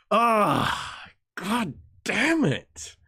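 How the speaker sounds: slow, drawn-out agonized curse; hammed-up anguish; anguished groan. Real, not acted. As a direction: drag it out in mock despair. Anguish over admiring Hegel.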